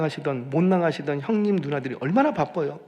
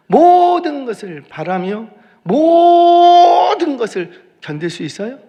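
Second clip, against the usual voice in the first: 모든 drawn out high and loud each time; preacher hammering 모든, slow and high; stress on 모든; 10 semitones higher, 12 dB louder, slower than usual. Shown as a demonstration. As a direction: belt out a long 모든, building louder. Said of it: "모든 것이에요".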